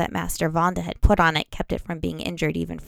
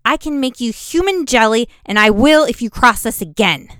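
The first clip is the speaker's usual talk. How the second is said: threatening; 4 semitones higher, 6 dB louder than usual. A narrator's reading. Threatening the bully.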